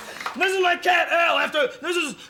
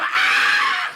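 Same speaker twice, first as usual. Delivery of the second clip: frustrated yelp; 4 dB louder than usual. Acted. Comic frustrated outburst.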